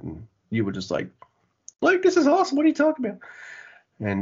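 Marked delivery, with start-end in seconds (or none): 1.8-3.2 much higher, squeaky voice mimicking his co-host; mocking high voice. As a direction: parody your friend in a silly high voice. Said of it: "if you're gonna do the high voice".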